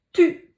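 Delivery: tu said high and loud, clearly; syllable reveal. Shown as a demonstration. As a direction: announce tu brightly. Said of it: "ça donne la syllabe". (tu annoncé haut et fort)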